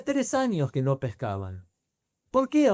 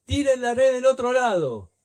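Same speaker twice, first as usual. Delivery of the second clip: high, loud shout across the water; a stranger on the shore shouts to the boat; calling out from the shore; 7 semitones higher, 5 dB louder than usual. Retold or imitated. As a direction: call out to fishermen far off the shore. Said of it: "alguien de la orilla les grita".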